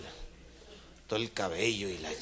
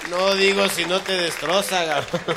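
loud; 7 semitones higher, 11 dB louder than usual. Acted. Sigue exclamando fuerte.

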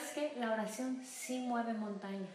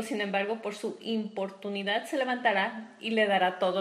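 preaching scripture; 10 dB louder than usual. Acted. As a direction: proclaim the verse to the church. Reading scripture at full force.

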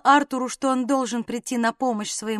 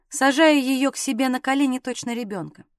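ordinary delivery

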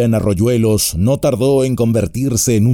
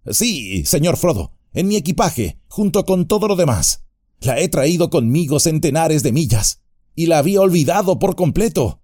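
hobbit dialogue; 6 semitones higher than usual. A narrator's reading. Sam and Frodo dialogue.

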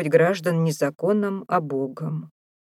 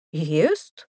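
incredulous one-word question; surprised query with a sharp upward jump; astonished. A narrator's reading. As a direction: echo it back in amazement. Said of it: "изумился Шонни".

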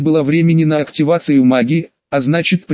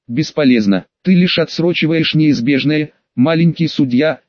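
plain reading voice

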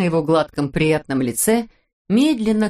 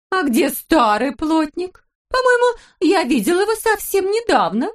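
cooing wife; 11 semitones higher than usual. A narrator's reading. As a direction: coo at your husband. Wife character voice. (выше и громче, сюсюкая)

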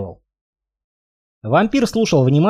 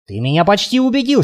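usual speaking voice

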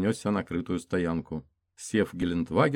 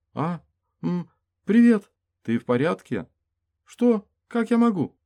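high dazed female voice; 12 semitones higher, 8 dB louder than usual. Acted. Dazed woman's replies.

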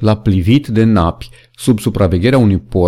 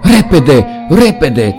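loud urgent cry, higher; shouting; 5 semitones higher, 3 dB louder, much slower than usual. A narrator's reading. Benjamin galloping up, shouting to the animals urgently. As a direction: yell it out in alarm. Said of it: "țipă el".

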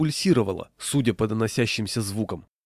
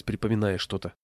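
ordinary delivery

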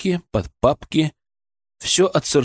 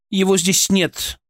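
curt and rude; 8 semitones higher, slower than usual. A narrator's reading. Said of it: "резко, почти грубо".

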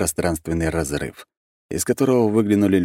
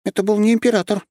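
sudden remark; 5 dB louder than usual. A narrator's reading. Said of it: "неожиданно произнесла Нюша".

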